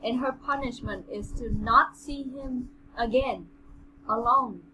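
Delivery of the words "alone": ordinary delivery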